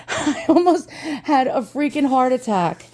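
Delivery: high, giggly disbelief; laughing with relief. Real, not acted. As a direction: laugh through the words after a fright. Relieved laughter after empty-box scare.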